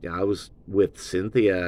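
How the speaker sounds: ordinary talk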